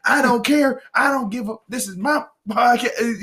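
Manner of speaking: mock an unfiltered podcaster going off; blunt, defiant rant. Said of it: "he would just like".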